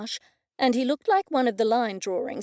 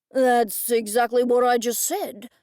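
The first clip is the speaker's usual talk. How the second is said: loud, baffled big man; blunt confusion; gruff puzzled man; 5 dB louder than usual. A narrator's reading. Dennis puzzled.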